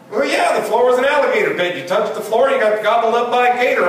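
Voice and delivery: matter-of-fact game explanation; audience guy. Retold or imitated. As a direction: fan describing his version. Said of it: "guy explains".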